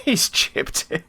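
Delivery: high disbelieving laugh through the words. Real, not acted. Gamer watching a chipped penalty, laughing in disbelief. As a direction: laugh at the cheeky chip.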